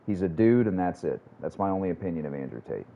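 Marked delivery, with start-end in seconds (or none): none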